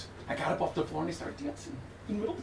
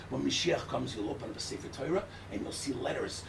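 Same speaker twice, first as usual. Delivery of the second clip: hushed whisper; 6 semitones lower, 6 dB quieter than usual. Acted. Rabbi hushing the room before the revelation, whispered. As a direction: whisper it like a secret.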